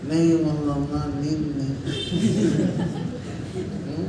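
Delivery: low, subdued mock reply; imitated low, soft, sleepy excuse; sleepless listener. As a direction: answer the preacher like someone short on sleep, quietly. Acted sleepless person answering the preacher.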